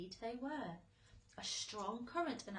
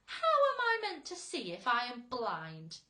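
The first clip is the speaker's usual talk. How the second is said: loud offended demand; angry shout; 4 semitones higher, 13 dB louder than usual. A narrator's reading. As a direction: hurl the question back. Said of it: "shouted the poor earthworm".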